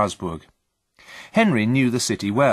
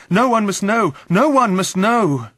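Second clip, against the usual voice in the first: high, loud panicked outburst, slowing on the repeat; desperate cry; 11 semitones higher, 6 dB louder than usual. A narrator's reading. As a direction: cry out to guard a secret. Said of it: "I cried".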